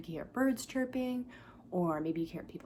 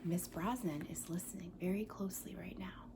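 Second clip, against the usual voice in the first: whisper; 2 semitones lower, 8 dB quieter than usual. Real, not acted. Listening quietly to the room.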